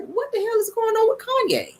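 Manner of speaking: high, breathy exasperated cry; mock disbelief. Exasperated quote of herself.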